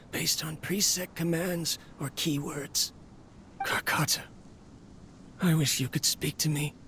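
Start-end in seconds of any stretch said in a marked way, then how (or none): 3.6-4.2 whispered name. Acted. Lonely scientist addresses silent robot.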